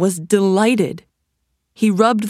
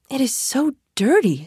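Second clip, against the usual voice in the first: higher-pitched mother voice; lightly acted fussing mother, pitched up; Aladdin's mother; 7 semitones higher than usual. A narrator's reading. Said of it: "said Aladdin's mother".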